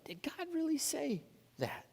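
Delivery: a serpent murmuring in Eve's ear; hushed insinuation. Acted. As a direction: plant the doubt quietly.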